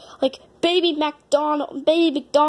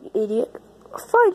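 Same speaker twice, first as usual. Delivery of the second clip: ordinary delivery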